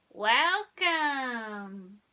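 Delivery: loud, slow alien greeting; welcoming alien. A narrator's reading. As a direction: greet the newcomers grandly. Alien voice in the story.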